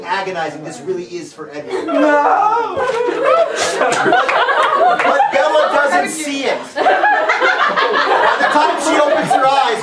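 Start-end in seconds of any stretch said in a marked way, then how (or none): 1.9-9.8 high, slow, overwrought wail; mock tragic narration; hammed-up melodrama. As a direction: wail it like a soap opera. Melodramatic wail over the scene.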